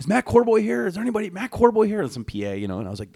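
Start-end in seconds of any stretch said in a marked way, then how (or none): 0.0-2.0 calling out. Retold or imitated. PA calling out in holding area.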